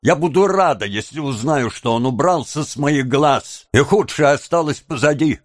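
high-pitched, embittered tirade; bitter outburst. A narrator's reading. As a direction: pour out hatred, high and heated.

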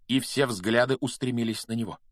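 ordinary delivery

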